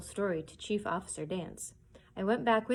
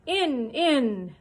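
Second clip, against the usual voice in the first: higher, louder furious order; raging; 6 semitones higher, 8 dB louder than usual. Acted. Blind man driving pirates inside, furious. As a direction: spit it out in rage. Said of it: "voice rang out ... afire with rage".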